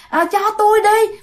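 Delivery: put-on high, mild, childlike begging; pleading ghost woman. Ghost woman asks mother for the baby, gentle pleading. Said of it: "bà không phải là, là, là là dữ tợn mà bà chửi đâu".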